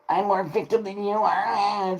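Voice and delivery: high drawn-out mock whine; mocking victim voice. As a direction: sneer at a self-pitying whiner. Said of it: "Victim hierarchy".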